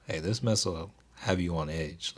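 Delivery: normal talking voice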